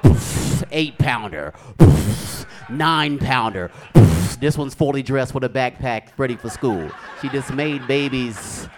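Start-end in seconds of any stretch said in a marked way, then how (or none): 0.0-0.6 one loud puffed cannon shot; sharp burst of air imitating a launcher; cannon poof. Imitates T-shirt cannon birth. 1.8-2.4 one loud puffed cannon shot; sharp burst of air imitating a launcher; cannon poof. Imitates T-shirt cannon birth. 3.9-4.4 one loud puffed cannon shot; sharp burst of air imitating a launcher; cannon poof. Imitates T-shirt cannon birth.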